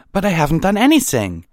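high, loud, fast outburst; protesting. A narrator's reading. Twilight protests to hostile strangers, high and loud. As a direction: protest your innocence at a high pitch. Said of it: "she protested".

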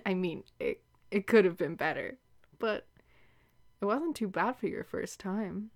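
ordinary delivery

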